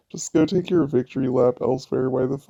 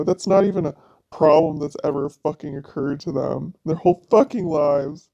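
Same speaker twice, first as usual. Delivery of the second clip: normal talking voice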